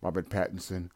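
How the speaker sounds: ordinary talk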